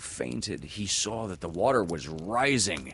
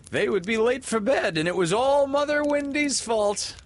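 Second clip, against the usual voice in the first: put-on chuckling boys; 6 dB louder than usual. A narrator's reading. Boys chuckling about bedtime.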